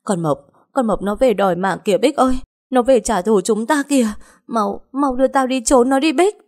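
high, frantic cry for help; acted hysterical alarm; panicked. A narrator's reading. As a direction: plead to be taken away, pitch climbing steeply. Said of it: "hoảng loạn nói".

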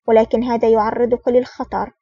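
plain reading voice

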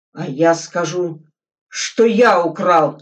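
loud, angry shout of confession; angry outburst. Acted. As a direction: blurt it out in anger. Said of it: "вдруг зло выкрикнул Глебов".

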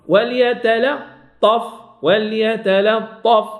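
وليتلطف drawn out slowly, light lam before the ta; slow tajweed demo. Shown as a demonstration. Teacher slowly models وليتلطف for students, careful recitation. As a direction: stretch وليتلطف syllable by syllable. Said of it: "فأحاول الفصل".

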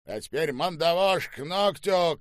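high, loud officer's order; shouted command. A narrator's reading. General gives soldiers a brutal order, shouted command. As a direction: bellow it with relish. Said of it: "командовал генерал".

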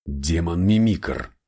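slow, startled inner thought; flash of thought. Acted. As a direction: think it with a sudden jolt. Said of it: "мелькнула мысль".

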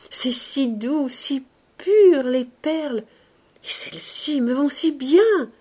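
high, loud, overjoyed exclamation; rapturous joy. A narrator's reading. Young woman gushing over her pearl necklace, rapturous. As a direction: gush with delight over the pearls. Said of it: "la joie qu'elle avait dans les yeux".

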